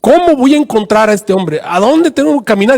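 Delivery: high-pitched, bewildered appeal to God; pleading prayer. Retold or imitated. Secuba on his knees asks God the way, pleading. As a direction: act out a man imploring God. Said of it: "cayó de rodillas y comenzó a orar".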